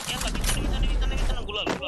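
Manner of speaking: shouting warning. Real, not acted. Warning teammates enemy behind.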